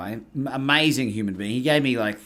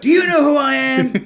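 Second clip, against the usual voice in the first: much higher, loud, mock-indignant challenge; indignant boast; 11 dB louder than usual. Coach defending himself to a critic, loud mock indignation. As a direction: puff up and demand to be recognised.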